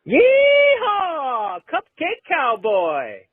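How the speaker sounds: high whooping yeehaw, then slow drawn-out cry; cowboy yell. Acted. Caller yelling his cowboy catchphrase, high and slow. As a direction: holler like a rodeo cowboy. Replayed clip.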